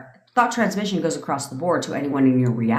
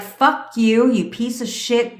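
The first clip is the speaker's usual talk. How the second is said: angry cursing; 6 semitones higher, slower than usual. Retold or imitated. Coach acting out the wrong way to be angry, an angry curse. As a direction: spit it out like you're losing your temper.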